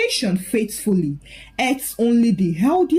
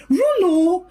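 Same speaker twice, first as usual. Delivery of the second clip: sudden frightened call for her husband; 9 semitones higher than usual. Acted. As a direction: call out to him in alarm. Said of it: "she called".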